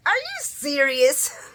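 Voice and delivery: slow, loud, stretched out; a hammed-up shocked Curtis; mock disbelief. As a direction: drag out the disbelief. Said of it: "Curtis is like".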